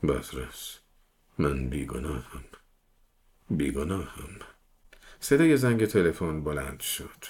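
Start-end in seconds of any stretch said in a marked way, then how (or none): 0.0-4.3 low, soft, husky murmur; choked murmur. A narrator's reading. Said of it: "با صدایی گرفته زمزمه کرد".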